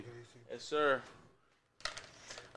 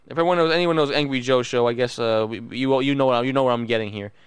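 ordinary delivery

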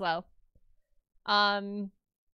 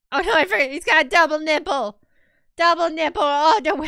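loud excited; 6 semitones higher, 11 dB louder than usual. Real, not acted. Suddenly remembers detail.

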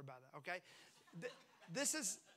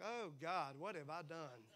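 dismayed; 6 semitones lower, 8 dB quieter than usual. Retold or imitated. Believer's sudden regret, retold.

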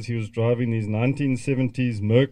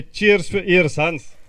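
loud, higher toast "Cheers for ears"; raised toast; 6 semitones higher, 6 dB louder, slower than usual. Real, not acted. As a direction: lead the crowd in a toast. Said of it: "And then we all say".

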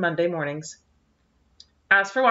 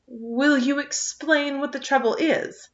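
dignified; 7 semitones higher, slower than usual. A narrator's reading. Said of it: "asked Anne in her most dignified manner".

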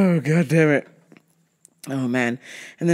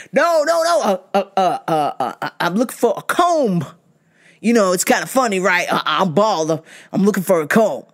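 stammering customer; 2 semitones higher than usual. Retold or imitated. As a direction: fumble the uhs, then land the joke. Customer explains joke, voiced.

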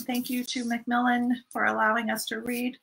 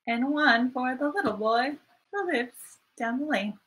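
sing-song rhyme, slow and even; nursery rhyme recital. Shown as a demonstration. Teacher reciting a rhyme to the class, sing-song. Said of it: "Say it again".

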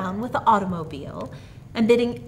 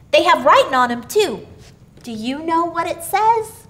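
put-on high, loud eager child's voice; little boy excitedly explaining to papa; 8 semitones higher, 5 dB louder than usual. A narrator's reading. Billy tells papa about the boards, eagerly.

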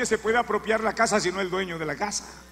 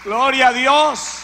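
louder, slow, drawn-out exclamation; preaching at full force; fervent praise; 4 semitones higher, 9 dB louder than usual. Real, not acted. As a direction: proclaim it to the whole church.

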